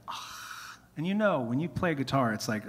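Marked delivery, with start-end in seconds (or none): 0.1-0.8 an airy, voiceless ah; sigh of delight. Retold or imitated. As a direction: breathe it out like the guitar just won you over. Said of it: "and I was like".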